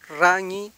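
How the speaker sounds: RAÑÍ loud, with a hard Spanish rolled r; wrong rolled r. Shown as a demonstration. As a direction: trill the first r of RAÑÍ forcefully. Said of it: "No es".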